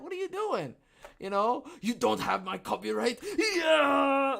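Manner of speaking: high, loud protest; mock outrage. Arguing with the company, high and loud. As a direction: yell it in hammed-up disbelief. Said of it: "I'm like".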